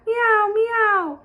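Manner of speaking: cat meow. Acted.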